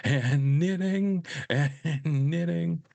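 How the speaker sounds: high, drawn-out repeated phrase; mock obsessive repetition. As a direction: stretch each "knitting" high, over and over. Comic imitation of repetitive fixation.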